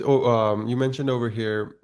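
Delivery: ordinary talk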